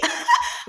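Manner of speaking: high-pitched amused laugh. Real, not acted. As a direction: burst out laughing at your own dark joke. Laughs at own gruesome example.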